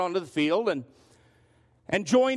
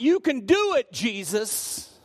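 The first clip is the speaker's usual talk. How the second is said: mock cheer; 4 semitones higher, slower than usual. Church fans cheering Jesus on, a high mock shout. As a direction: shout it like a fan in the stands. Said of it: "they're willing to shout out".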